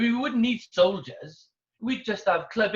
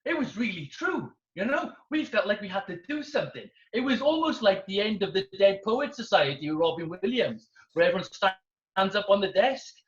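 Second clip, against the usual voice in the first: ordinary delivery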